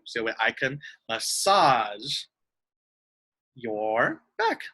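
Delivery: ordinary talk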